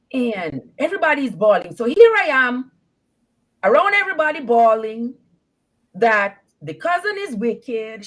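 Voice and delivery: ordinary delivery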